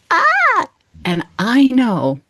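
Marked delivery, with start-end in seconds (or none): 0.1-0.7 high sudden mock yelp; a short cry as the bungee rope yanks her back; yelp of alarm. Retelling being pulled back, a high startled yelp. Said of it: "And I'm like".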